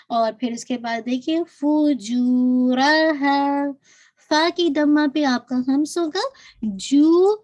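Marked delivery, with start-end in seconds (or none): 1.6-3.6 slow, drawn-out tajweed recitation; Quran recitation. Shown as a demonstration. Teacher recites the verse for her students, slow and melodic. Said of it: "اس کو to count پڑھیں گے". 6.6-7.4 جو said louder with no breath escaping; tajweed demo. Shown as a demonstration. Teacher models جو for her students, loud and airless. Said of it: "آپ بالکل year نہیں نکالیں گے".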